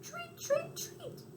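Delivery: very high thin treat chirps; cricket chirp. Retold or imitated. Cricket imitates another version of its chirp. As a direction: squeak it out like an insect. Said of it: "others say".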